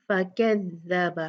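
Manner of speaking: फकज़्ज़ब with every letter light; slow light recitation. Shown as a demonstration. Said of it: "सारे letters light हैं".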